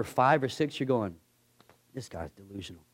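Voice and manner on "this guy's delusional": far softer, low muttered aside; grumbling aside. Retold or imitated. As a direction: say it under your breath so he won't hear. Servant grumbles about the prophet.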